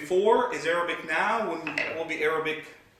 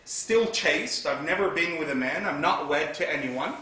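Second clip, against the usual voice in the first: normal talking voice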